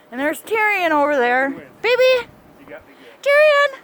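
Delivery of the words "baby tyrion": high, loud call to a dog. Real, not acted. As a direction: call the dog from across the yard. Calling the dog in snow.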